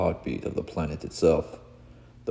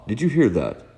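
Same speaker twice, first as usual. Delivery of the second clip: usual speaking voice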